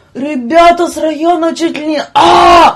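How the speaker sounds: loud, high street-lad yell; rowdy shout. Retold or imitated. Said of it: "там".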